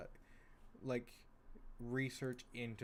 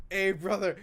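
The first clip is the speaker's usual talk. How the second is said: meme voice; 7 semitones higher, 12 dB louder than usual. Retold or imitated. Repeating meme line.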